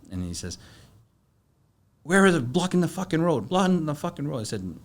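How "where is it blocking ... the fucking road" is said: hammed-up furious shouting; angry yell. Angry fisherman yelling at the narrator about the road.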